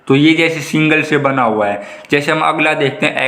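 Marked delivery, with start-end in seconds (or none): none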